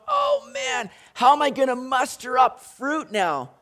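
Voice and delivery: sudden high squeak of worry; worried branch imitation; fretting branch. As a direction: fret over mustering fruit. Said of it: "branch is not sitting there going".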